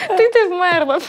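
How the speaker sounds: laughing high, stumbling. Real, not acted. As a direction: laugh out the retort. Laughing retort to the joke. (сміючись)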